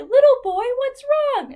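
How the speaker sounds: high, loud friendly bobcat. Acted. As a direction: pitch way up for a kindly cat. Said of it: "the bobcat said to the little boy".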